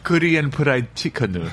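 slow, hammed-up Korean accent on "Korean fried chicken". Guest says his dish in an accent on the host's dare, slow and hammed-up. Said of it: "I'm gonna make you say it with the accent".

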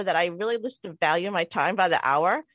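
usual speaking voice

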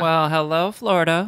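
high, loud mock Florida accent; bad Florida accent. Host greeting Florida listeners, bad Florida accent. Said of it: "That was the worst Florida accent".

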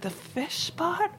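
new cook echoing the chef's order, lost; baffled echo. Retold or imitated. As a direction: echo the fish pot in slow high confusion. Confused cook repeating the order.

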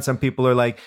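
usual speaking voice

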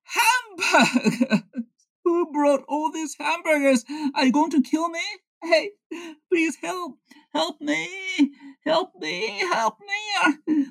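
very high, wailing terrified cries; screaming for help. Acted. Henry surrounded by hamburgers he claims to fear, screaming for help. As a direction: plead for rescue in a stretched, panicked squeal. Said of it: "He is screaming help, and I'm scared".

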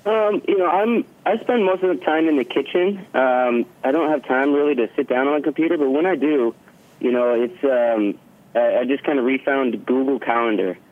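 caller heard over a phone; talking down a phone line. Real, not acted. Guest calling in by phone.

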